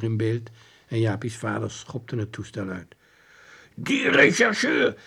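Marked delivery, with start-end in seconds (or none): 3.7-5.0 high, slow indignant call; angry shout. Acted. Father furious at the detective, high and slow. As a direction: shake your fist and shout it. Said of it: "riep hij vuistschuddend".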